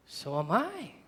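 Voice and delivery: quiet, modest comeback; understated answer. Acted. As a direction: drop to a hushed aside. Understated answer to loud challenge.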